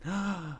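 soft audience gasp at a twist; shocked gasp. Pretend. Reacting to the stabbing twist.

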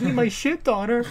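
high, haughty toff voice; mock posh father; pompous father. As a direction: sneer it grandly at the daughter. Father's retort.

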